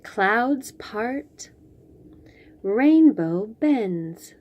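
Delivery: usual speaking voice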